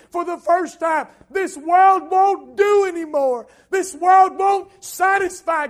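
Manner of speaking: loud, high-pitched sermon climax; full-force preaching. Real, not acted. Preacher testifying about the day of his salvation, full-force preaching. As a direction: build to a shouted, impassioned testimony.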